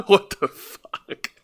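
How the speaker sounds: slow, breathless whisper; whispered disbelief. Real, not acted. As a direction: whisper it, stunned. Stunned whisper.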